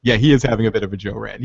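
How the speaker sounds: ordinary delivery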